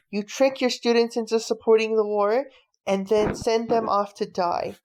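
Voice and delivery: usual speaking voice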